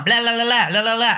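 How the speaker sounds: high, droning blah-blah parody; mock blather. Mocking authors who ramble about their book, high mock blather. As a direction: mimic someone rambling on. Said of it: "And they blather on".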